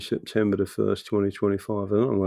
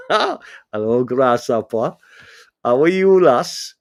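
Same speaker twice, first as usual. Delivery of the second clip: a hint of a Welsh lilt, louder; Welsh accent; 6 dB louder, slower than usual. Light Welsh accent greeting a viewer in chat. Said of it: "I'm gonna put my Welsh accent in".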